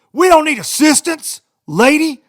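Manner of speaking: imitating a passenger's furious yell. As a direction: bark it at the lady, fed up. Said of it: "he's, like, yelling at this lady".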